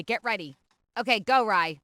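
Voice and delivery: ordinary delivery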